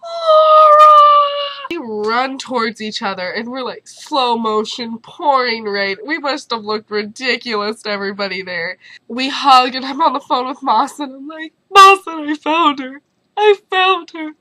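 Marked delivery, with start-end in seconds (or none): 0.0-1.7 very high, loud drawn-out shriek of a name; screamed call. Retold or imitated. Friend spotting her in the rain, screamed call. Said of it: "She goes". 11.7-14.3 high, loud overdramatic phone cry; dramatic. Retold or imitated. Telling her advisor she found her friend, dramatic. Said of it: "All dramatic, right?".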